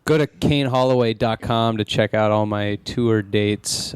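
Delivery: ordinary delivery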